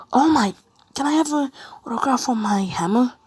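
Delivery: high, loud, excited fanboy plea; starstruck fan. Acted. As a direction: burst out excitedly at meeting an idol. Fan spots his idol All Might.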